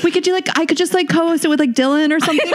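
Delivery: high, light imitation of her co-host; mock co-host offer. Host imitating her co-host offering a stand-in, lightly mocking. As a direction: tease her by mimicking the offer. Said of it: "She's like".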